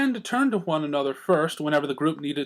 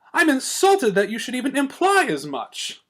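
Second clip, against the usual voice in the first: higher, lofty offended lady; grand air; 9 semitones higher than usual. A narrator's reading. Rarity insulted by Twilight, lofty. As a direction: sound wounded and lofty. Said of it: "taking on a grand air".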